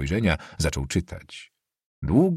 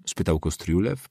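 ordinary delivery

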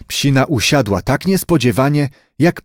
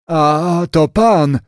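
lightly voiced grandfather, higher; slow, drawn-out start, then a higher question, as a hint of Grandpa; old grandfather; 6 semitones higher, slower than usual. A narrator's reading. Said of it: "spytał dziadek chłopca".

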